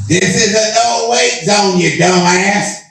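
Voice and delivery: high-pitched mock yell; high, indignant imitation of someone screaming across water; imitated scream. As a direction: holler it like an irate boater. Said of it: "this guy screams out".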